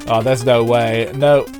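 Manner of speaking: ordinary delivery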